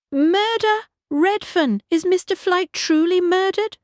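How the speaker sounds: high, loud aristocratic demand; an upper-class lady insisting on an answer. A narrator's reading. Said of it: "Lady Rose demanded".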